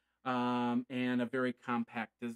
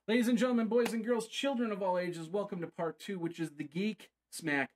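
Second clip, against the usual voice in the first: showman announcer; 7 semitones higher than usual. Acted. Show host opens the segment.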